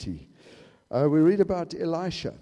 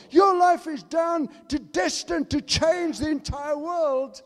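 loud, high-pitched revival preaching; rising, ringing pulpit cry; fiery preaching; 9 semitones higher, 6 dB louder than usual. Acted. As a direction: preach it full force to the congregation. Preaching builds to full force.

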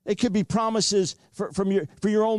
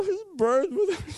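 sobbing voice; 9 semitones higher than usual. Retold or imitated. Retelling crying to wife.

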